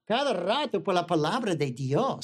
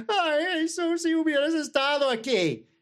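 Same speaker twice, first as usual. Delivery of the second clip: tearful plea; 8 semitones higher than usual. Acted. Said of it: "Allí está Marta y María llorando".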